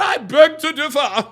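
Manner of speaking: slow mock-proper quote, breaking into laughter; fake proper talk. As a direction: draw out the affected diction mockingly. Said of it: "fake ass proper talking ass".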